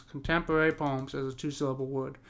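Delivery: ordinary delivery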